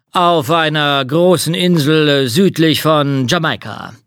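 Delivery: ordinary delivery, nothing marked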